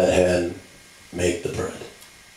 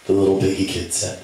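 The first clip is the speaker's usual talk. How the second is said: ordinary talk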